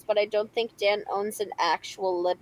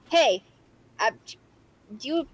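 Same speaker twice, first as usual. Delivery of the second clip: lightly hammed-up Minnesota voice; Minnesota accent; 3 semitones higher than usual. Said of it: "This is my Minnesota voice".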